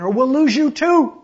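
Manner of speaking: urgent shout, high and very loud; yelled warning. A narrator's reading. Uncle warns the swimmer from the boat, yelling. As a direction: yell to save someone from drowning. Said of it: "yelled Uncle Reuben again".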